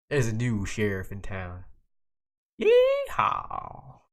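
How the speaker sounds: badly faked Texan accent building to a piercing whoop. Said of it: "pretty bad at that Texan accent".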